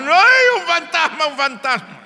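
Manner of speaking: shrieking high, then fading down; panicked scream. Acted. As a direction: scream it like you just saw a ghost.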